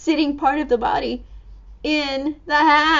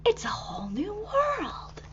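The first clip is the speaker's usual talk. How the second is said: whispered in wonder, softer; awed whisper; 6 semitones higher, 10 dB quieter than usual. Acted. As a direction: breathe it out in awe. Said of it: "Hattie says".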